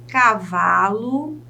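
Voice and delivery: slow "cavalo", VA stretched, ending rising; stressed syllable exaggerated. Shown as a demonstration. Teacher has students find the stress, "cavalo" stretched on VA. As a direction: draw out "cavalo" on its middle syllable. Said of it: "tente analisar qual dessas sílabas é a sílaba tônica".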